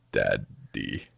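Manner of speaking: hushed, deep creepy impersonation; creepy daddy. Retold or imitated. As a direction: imitate a creep with a very low, soft "Daddy". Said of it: "just the way he says".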